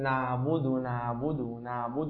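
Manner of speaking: نعبد with a firm, tight ع; tajweed demo. Shown as a demonstration. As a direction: press the ع in نعبد hard. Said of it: "فحاول أن تقول".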